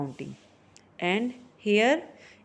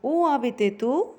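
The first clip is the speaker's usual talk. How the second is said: rising questioning voice on "U abite tu?"; higher question pitch; 4 semitones higher than usual. Shown as a demonstration. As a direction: ask "U abite tu?" with a raised pitch. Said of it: "a little bit higher when you are asking".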